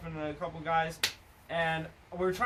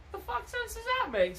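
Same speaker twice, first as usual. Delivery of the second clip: incredulous; 14 semitones higher than usual. Retold or imitated. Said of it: "I'm like".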